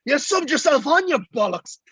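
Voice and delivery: high-pitched exasperated scolding, retold; mock telling-off. Substitutions man confronts the player, mock exasperated telling-off. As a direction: scold him in mock outrage. Team manager tells off the player.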